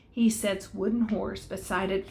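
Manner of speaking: usual speaking voice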